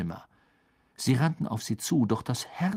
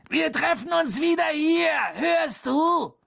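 high, raised voice; calling out; 4 dB louder than usual. A narrator's reading. Harry gives Hermine the meeting place, calling high. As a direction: make sure they hear you through a door.

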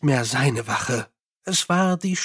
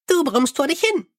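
high, chirpy little imp; 11 semitones higher than usual. Acted. Imp soothes the angry Mumm, high and chirpy. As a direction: pipe it up high like a tiny helpful imp. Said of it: "antwortete der Kobold".

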